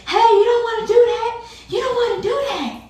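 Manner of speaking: high, loud warning call; neighbor's warning shout. Retold or imitated. Neighbor yelling at the man to stop, high and loud. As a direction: shout a warning across the lot to stop him. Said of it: "I remember hearing my neighbor say".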